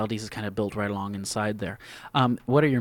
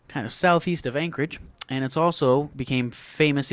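ordinary talk, nothing marked

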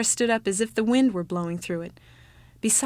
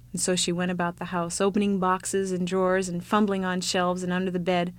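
plain reading voice

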